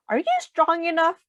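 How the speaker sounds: lightly voiced boy, doubting; Joey's doubtful question. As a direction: ask it like a skeptical kid. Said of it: "replied Joey".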